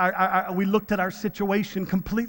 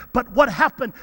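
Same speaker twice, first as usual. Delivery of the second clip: usual speaking voice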